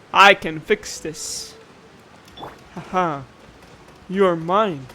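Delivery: ordinary talk, nothing marked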